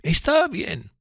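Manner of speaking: assent in a high character voice; assenting Miss Havisham. Acted. Miss Havisham accepting Pip's explanation, high voice.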